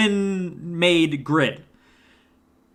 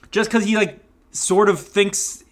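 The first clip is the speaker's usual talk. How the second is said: ordinary talk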